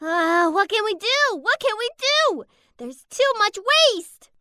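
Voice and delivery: high, fretting wail; anxious. Acted. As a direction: fret helplessly as if hope is running out. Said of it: "anxiously around the rubbish dump".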